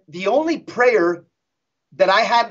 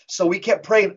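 normal talking voice

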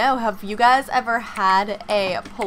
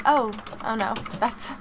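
usual speaking voice

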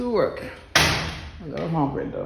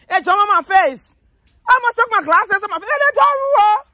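startled squeal at dog; 4 dB louder than usual. Real, not acted. Dog jumps on her face.

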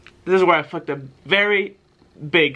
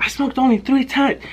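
put-on pleading kid; 9 semitones higher than usual. Kid changes answer pleading.

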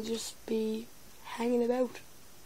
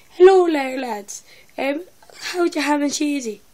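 a light Irish YouTuber greeting, loud on Hello; lightly voiced Irish lad, bursting out with Hello; Bread impression; 4 semitones higher, 8 dB louder than usual. Teen impersonating Bread for his vlog, hammed-up Irish greeting. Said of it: "first I decided to start off with".

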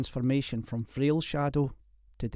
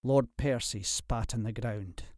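ordinary delivery, nothing marked

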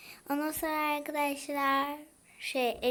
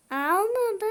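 surprised cry; 8 semitones higher, 7 dB louder than usual. Real, not acted. Game action fails.

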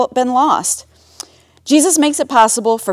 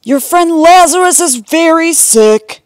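higher-pitched, earnest plea; worried messenger; 6 semitones higher, 4 dB louder than usual. A narrator's reading. Neighbor telling Jesus his friend is sick, worried. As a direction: deliver bad news with concern. Neighbor brings urgent bad news.

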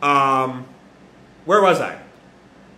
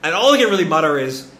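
usual speaking voice

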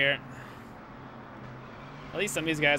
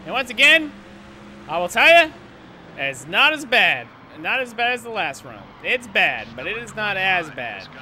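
emphatic; 5 semitones higher, 7 dB louder than usual. Real, not acted. Loud emphatic game commentary.

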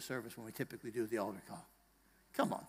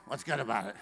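lower-pitched grumpy comeback; joy-sucker grumble; 3 semitones lower than usual. Acted. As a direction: answer a greeting like a killjoy. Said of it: "those joy suckers in your life".

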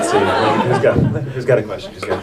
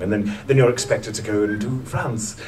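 ordinary talk

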